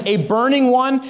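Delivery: usual speaking voice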